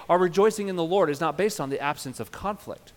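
ordinary delivery

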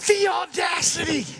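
high, indignant exclamation; mock outrage. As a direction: exclaim it as if scandalized.

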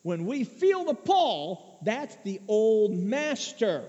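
high, loud and very slow; slow emphatic preaching. Real, not acted. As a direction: lean hard on each word.

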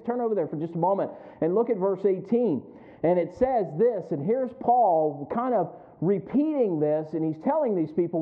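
normal talking voice

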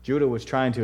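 ordinary delivery

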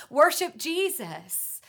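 slow, loud, high call; loud climax. Real, not acted. Preacher urging tired listeners, loud and slow. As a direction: ring it out as the final call.

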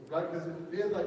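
ordinary talk, nothing marked

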